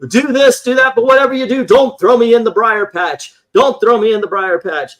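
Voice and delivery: high, loud hammed-up begging; mock pleading. Brer Rabbit begging the fox not to throw him in, mock panic. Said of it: "he's like".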